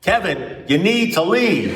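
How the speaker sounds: high, drawn-out plea to leave; emphatic urging. Retold or imitated. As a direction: spell it out for a panicking friend. Exasperated advice to panicking friend.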